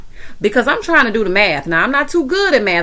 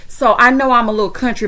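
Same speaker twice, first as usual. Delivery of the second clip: ordinary talk, nothing marked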